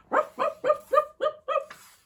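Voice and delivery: very high, loud puppy yaps; yappy puppy barks. Acted. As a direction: bark like an excited little dog. Puppy-wolf barking in story.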